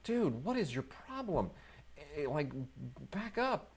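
exasperated dude. Retold or imitated. Reviewer mocking Robin Williams' ranting character, exasperated and high. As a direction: tell an overacting guy to calm down.

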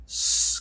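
drawn-out sss; voiceless hiss. Shown as a demonstration. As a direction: repeat the hiss, no voice.

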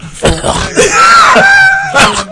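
wheezing shriek of laughter; loud laughing fit. Real, not acted. Reacting to the joke, loud laughing fit. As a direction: lose it completely.

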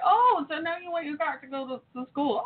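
high, sarcastic "oh, now you need it"; mother mocking her daughter's late car panic, high and sarcastic; sarcastic mock surprise.

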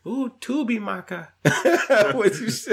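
high, breathy mock rapper voice. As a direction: toss it off in a silly put-on voice. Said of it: "Goo Goo Gaga impression".

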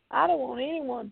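ordinary delivery

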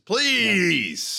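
shouted please, Samuel Jackson style; yelled please. Retold or imitated. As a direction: bark out the word please. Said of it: "he says".

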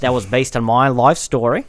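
Australian wildlife presenter spoof; Jack Wallaby, Australian accent. Acted. Presenting his horse dog to the host, Australian accent. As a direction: play an Australian animal showman. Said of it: "Like Jack Wallaby".

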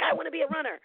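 put-on high, eager child voice; excited kid. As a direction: announce a dream like a kid. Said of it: "so I was like".

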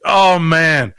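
loud, high mock dismay; imitated freaked-out buddy; alarmed reaction. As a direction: blurt it loudly like a spooked friend. Shane's alarmed reaction acted out.

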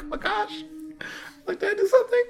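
panicked inner voice. Retold or imitated. Retold panicked inner thought.

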